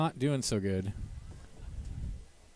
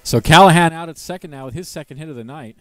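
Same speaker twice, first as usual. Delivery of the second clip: excited; 4 semitones higher, 12 dB louder than usual. Real, not acted. Recap after the big hit.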